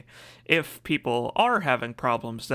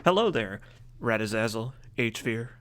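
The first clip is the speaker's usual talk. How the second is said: usual speaking voice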